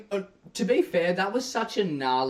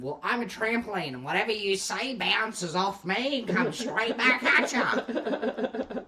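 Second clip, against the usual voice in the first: mock childish taunt; 6 semitones higher than usual. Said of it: "the full-grown new evolved version of".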